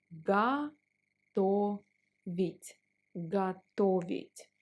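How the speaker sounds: ordinary talk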